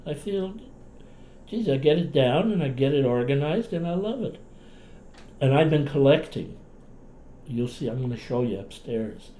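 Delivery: ordinary talk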